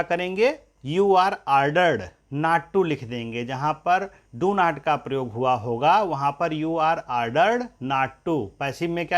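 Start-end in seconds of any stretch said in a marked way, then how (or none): 0.9-2.9 very slow, word by word; deliberate teacher dictation. Shown as a demonstration. Teacher dictates English sentence slowly. 6.5-8.5 very slow, word by word; deliberate teacher dictation. Shown as a demonstration. Teacher dictates English sentence slowly.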